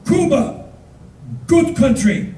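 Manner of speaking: high, emphatic short words; proud toast. Retold or imitated. Bus driver toasts with handshakes, high and proud. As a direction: proclaim it as a hearty toast.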